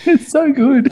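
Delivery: tiny mock-delighted squeal; squeaky high repeat. Real, not acted. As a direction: echo yourself in a silly high squeak. Repeats the line as a joke.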